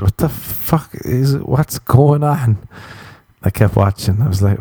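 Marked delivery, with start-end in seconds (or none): 0.0-2.6 slow, higher bewildered what the fuck; drawn-out confusion at the screen; baffled outburst. Retold or imitated. Watching a weird film, bewildered and slow. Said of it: "so many times I said".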